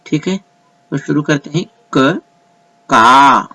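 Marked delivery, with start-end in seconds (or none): 1.9-3.4 each syllable said clearly with a pause between, the long vowels held; क short and clipped, का drawn out and louder; teacher's syllable drill. Shown as a demonstration. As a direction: spell out the Kannada syllables slowly for the class. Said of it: "जिस हरूफ पर एक number दिया गया है उसे कम खींचते हैं".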